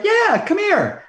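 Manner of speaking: high, slow, friendly call to come over; imitating a friendly actor waving him over; welcoming invite. Fan imitating the actor inviting him over, high and slow. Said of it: "And he's like".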